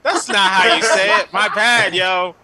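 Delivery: imagined friend correcting. Retold or imitated. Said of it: "Chris's head was like".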